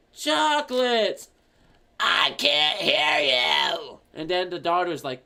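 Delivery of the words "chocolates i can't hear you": put-on slow, loud deaf-old-lady shout. Old lady who can't hear her daughter, slow shouting old voice. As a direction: bellow it, stretching every word. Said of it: "the very old lady comes in".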